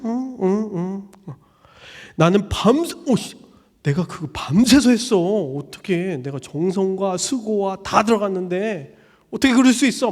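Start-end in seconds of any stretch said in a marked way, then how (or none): 0.0-1.3 slow indifferent uh-huhs; bored grunts. Retold or imitated. 3.1-10.1 loud indignant complaint; hurt anger. Retold or imitated. Giver angry gift was trashed.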